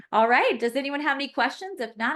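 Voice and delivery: ordinary talk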